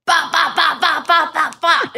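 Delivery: quick, high popping mouth gunshots; imitated gunshots. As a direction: pop out each shot like gunfire. Imitating gunshots outside the house.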